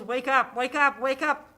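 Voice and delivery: child mimicking a yelling phone; imitated alarm yell. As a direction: raise it loud and high, mimicking. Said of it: "that thing that yells".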